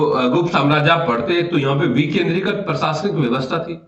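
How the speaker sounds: ordinary delivery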